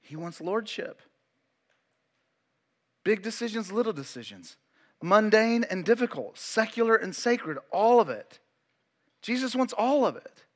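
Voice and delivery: normal talking voice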